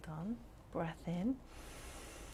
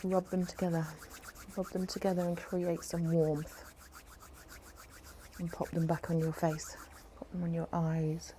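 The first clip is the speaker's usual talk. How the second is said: guided meditation. Acted. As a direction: ease them into calm. Leads a relaxation meditation.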